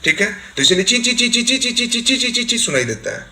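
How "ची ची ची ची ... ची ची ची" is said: rapid, high-pitched imitated chirps; high-frequency bird chirping. Shown as a demonstration. Teacher imitating a small-beaked bird, high repeated cheeps. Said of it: "बहुत high frequency में भेजती है".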